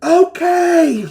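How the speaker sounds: high, full-voiced yell; loud in-character shout. Man outside answering the party, high shout.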